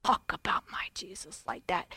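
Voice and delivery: hushed unvoiced whisper; woman whispering back to the stranger. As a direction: whisper it close and firm. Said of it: "I said".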